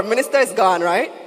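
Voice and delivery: normal talking voice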